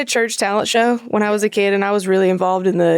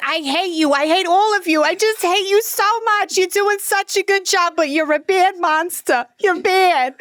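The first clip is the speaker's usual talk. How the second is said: high, hammed-up Brooklyn mom accent; Fran Drescher; 9 semitones higher than usual. Mom cornered by haunt actors, high mock-scolding Brooklyn accent. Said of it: "talks like Fran Dresser".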